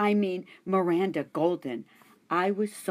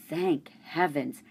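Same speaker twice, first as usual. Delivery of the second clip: usual speaking voice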